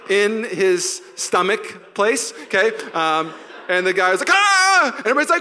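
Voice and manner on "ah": loud, high yelp; acted yell of someone kicked; hammed-up pained outburst; cry of pain. Acts out kicked player.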